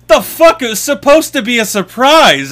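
high, loud outraged disbelief; indignant surprise. Retold or imitated. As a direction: protest the spoiled surprise. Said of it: "And he goes".

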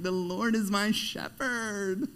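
lightly hammed-up woo girl quoting the psalm, slow; mock the sing-song Bible quote; silly put-on voice. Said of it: "I don't know where this voice came from".

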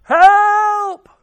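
high, loud, drawn-out yell; long shouted call; yelled cry for help. Acted. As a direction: yell for help as if lost and alone. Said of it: "you yelled out".

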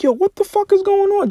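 high, incredulous cry of frustration; shrill-free high-pitched what-the-hell outburst; exasperated disbelief. Retold or imitated. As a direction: throw it up high like you can't believe it. Frustrated when nothing works.

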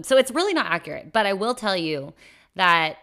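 ordinary delivery

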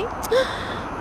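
high breathy sob gasp; mock sob gasp. Choking up over her dog, a mock sob gasp. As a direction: catch your breath in a fake sob. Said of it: "Because I'm like".

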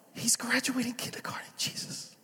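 whispered aside in mock disbelief; under his breath, amazed. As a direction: breathe it out like a secret.

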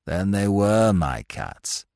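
low, slow, mild dejection; resigned, drawn-out regret; sad. A narrator's reading. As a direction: say it ruefully, dragging the words. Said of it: "he said sadly".

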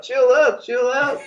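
high, half-shouted chill out; mock panicked yell at the phone; frantic plea. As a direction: cry out to calm him down. Pleading with an angry caller.

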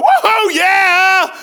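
high, loud celebratory shout; exuberant whoop. Shown as a demonstration. Preacher showing the congregation a happy believer's cheer, exuberant whoop. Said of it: "If you're not going".

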